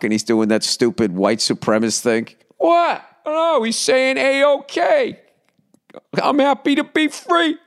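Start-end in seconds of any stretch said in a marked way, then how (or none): 2.6-7.6 very high falsetto mock voice; absurdly high-pitched mimicry; mocking defender. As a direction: play the innocent in a squeaky put-on falsetto. Mocking defence of man in photo.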